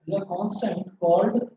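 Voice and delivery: slow dictation, word groups split by long pauses; classroom dictation. Real, not acted. Teacher dictating a physics rule to students, slow dictation. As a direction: dictate slowly so students can write.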